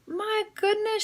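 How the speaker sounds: very high startled gasp of words; cried out. Acted. Mrs Hope drops her tea, crying out high. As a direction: shriek it in surprise. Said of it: "she cried".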